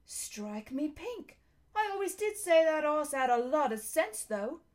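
lightly voiced cabby, rising into emphasis; a touch of a cabby's amazement; astonished cabby. A narrator's reading. Cabby marvelling that the horse talks, lightly voiced astonishment.

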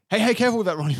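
a playful hey-careful scold; quoting the film's scold; quoting a character. Retold or imitated. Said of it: "she goes like".

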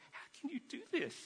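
high, soft voice of disbelief; mild, pained indignation, retold; retold angry protest. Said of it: "I'm just so angry. I'm saying".

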